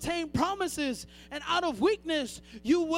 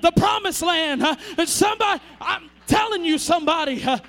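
preaching at full force; 3 semitones higher, 7 dB louder than usual. Acted. Preacher pounding the pulpit for the church, full-force shout. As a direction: shout the sermon home to the congregation.

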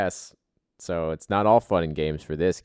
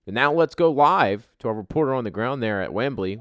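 loud, higher anchor toss to the field; news anchor voice; 5 semitones higher, 6 dB louder than usual. Acted. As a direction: announce it like a TV sports anchor. Host mock-tosses to live reporter.

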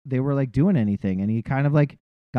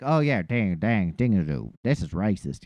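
mock Southern accent; 8 semitones lower, 6 dB quieter than usual. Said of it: "not even like the Southern accent".

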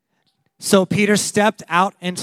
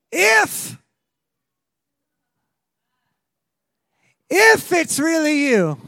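higher, slow, doubtful plea; hesitant Peter; 8 semitones higher, 3 dB louder, much slower than usual. Retold or imitated. Peter calling to Jesus on the lake: high, slow, doubtful. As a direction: call out unsure, slowly.

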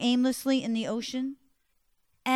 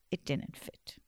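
plain reading voice

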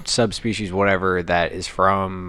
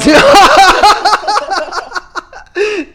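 loud high laugh; 10 dB louder than usual. Real, not acted. Big burst of laughter.

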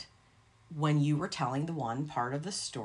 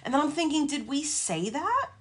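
alarmed; 11 semitones higher than usual. Retold or imitated. Panicked retelling of confusion.

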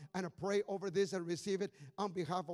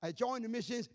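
normal talking voice

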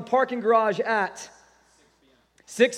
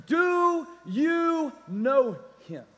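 DO, YOU, KNOW, HIM each drawn out; very slow, spaced-out delivery for weight; slow, emphatic question; much slower than usual. Real, not acted. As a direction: stretch every word of the question, word by word. Preacher repeats his key question.

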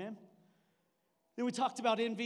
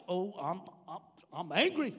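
halting start, then louder burst; mock anger; 2 semitones lower, 5 dB louder than usual. Preacher acting out people leaning into anger, mock anger. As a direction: stammer into a put-on angry outburst. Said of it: "When anger comes your way".

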